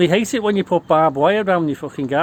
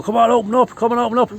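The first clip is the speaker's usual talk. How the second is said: louder and higher, a pounding police demand; police shouting; 7 semitones higher, 4 dB louder than usual. Retold or imitated. Police banging on the window of a mate, shouting. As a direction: bark it through the window like an impatient cop. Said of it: "they're banging on the window".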